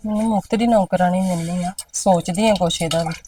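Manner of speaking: softly to herself, not for mother's ears; a grumbling thought under her breath; muttered aside. Acted. Daughter grumbling to herself about mother's plans, hushed.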